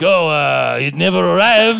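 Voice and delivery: French buyer claiming the record never came, mock French accent. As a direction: whine it like a French eBay buyer, in a put-on French accent. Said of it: "Can you do the rest of the podcast in that French accent?".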